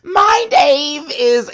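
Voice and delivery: very high, shouted impression; Kitty. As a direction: squeal it out loud, hammed up. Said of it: "when Kitty had the nerve to go".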